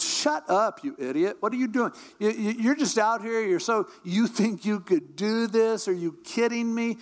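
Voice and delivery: high-pitched, exasperated telling-off; scolding older brother. Acted. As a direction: berate a foolish kid brother.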